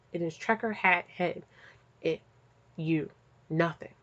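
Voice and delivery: normal talking voice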